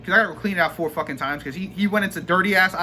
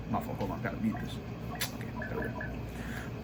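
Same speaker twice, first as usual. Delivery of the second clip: whispered aside; 11 semitones lower, 15 dB quieter than usual. Real, not acted. Vlogger fixing his mic mid-rant, whispering.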